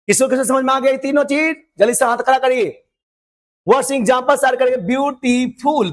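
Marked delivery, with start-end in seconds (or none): 4.8-5.9 beautiful slowly in three separate beats; broken into syllables. Shown as a demonstration. Teacher showing three parts, beautiful slowly syllable by syllable. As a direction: divide beautiful into view, टी, फुल. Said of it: "तीन syllable का word है".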